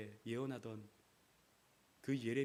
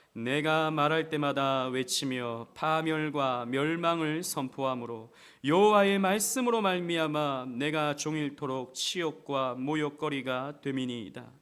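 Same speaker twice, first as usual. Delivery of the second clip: slow, loud, measured verse reading; slow unison reading; 2 semitones higher, 11 dB louder, slower than usual. Acted. Pastor leading the church in reading, slow and loud. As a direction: read it together, word by word. Congregation reads together.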